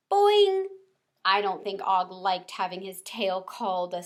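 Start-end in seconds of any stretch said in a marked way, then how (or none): 0.1-0.7 high loud springy boing; frog boing. A narrator's reading. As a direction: bounce it like a cartoon frog. Frog's sound.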